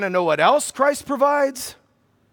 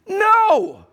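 a sharp, much higher and louder no; emphatic no; 11 semitones higher, 5 dB louder than usual. Real, not acted. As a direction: slam down the answer. Preacher's emphatic answer.

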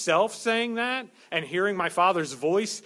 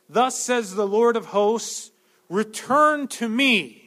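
loud, slow, ringing proclamation; slow, loud pulpit reading of the verse; proclaiming scripture; 2 semitones higher, 4 dB louder than usual. Acted. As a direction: call it out as God's word.

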